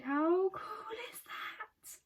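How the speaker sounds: high-pitched awestruck gushing; overwhelmed delight. Real, not acted. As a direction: gush as if moved almost to tears. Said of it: "I want to cry".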